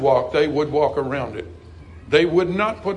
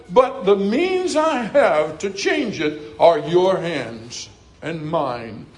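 raised, stretched-out sermon delivery; Southern pulpit fervor; impassioned preaching; 3 semitones higher, 4 dB louder, slower than usual. Real, not acted. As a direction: preach it with rising conviction, drawing out key phrases.

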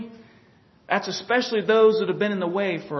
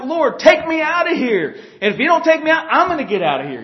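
raised, high-pitched demand, easing at the end; exasperated plea; 6 semitones higher, 5 dB louder than usual. Acted. Fed-up believer begs the Lord for escape, exasperated. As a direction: play a believer threatening to bolt.

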